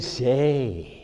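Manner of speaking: drawn-out, odd character voice; funny-talking barber. Retold or imitated. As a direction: imitate the barber, stretching the word. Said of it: "But he talked funny".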